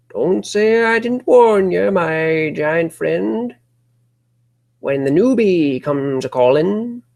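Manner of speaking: high, slow ominous warning; creepy old local. Acted. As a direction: drag out the warning like a crazed yokel.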